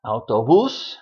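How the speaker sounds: "Autobús" as au-to-BÚS, BÚS punched; stress on last syllable. Shown as a demonstration. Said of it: "stressed where that accent mark is".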